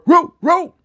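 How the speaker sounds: high playful roo roo like a pup; dog woof imitation. Host barks hello to a viewer's dog, high and playful. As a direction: bark a happy little roo roo for the dog.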